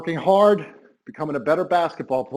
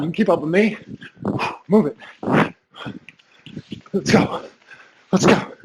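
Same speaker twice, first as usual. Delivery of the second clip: barking gym coach; slower than usual. Real, not acted. Coach drilling while doing it himself.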